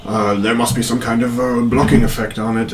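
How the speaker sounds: lightly voiced apologetic advisor, halting; Penlarith. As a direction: stammer out an apology to a lord. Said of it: "you just kinda hear Penlarith's voice".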